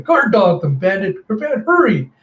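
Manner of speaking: put-on high, loud girl ordering her pet; urgent command. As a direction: call out orders in a hurry. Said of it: "Mila just commanding Bandit".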